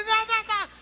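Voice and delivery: very high mock babble; tongues babble. Preacher mimics a woman behind him in tongues, very high.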